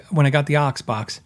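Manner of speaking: ordinary talk, nothing marked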